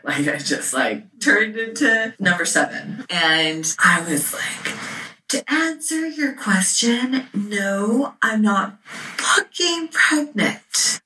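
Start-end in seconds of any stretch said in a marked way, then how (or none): 5.3-11.0 slow, high, deliberate furious retort; retold rage. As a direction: act out seething indignation, drawing out each word. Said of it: "filled with rage".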